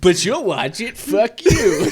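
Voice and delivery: hammed-up trailer voice breaking into laughs; movie trailer voice. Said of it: "like, the movie trailer voice".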